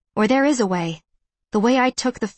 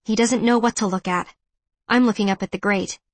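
usual speaking voice

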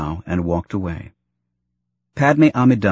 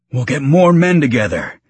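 higher, frantic character voice; rising frantically; 5 semitones higher, 4 dB louder than usual. A narrator's reading. Injured father rallies a search party, voice rising frantically. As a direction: let the pitch climb in desperate urgency. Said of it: "his voice rising frantically".

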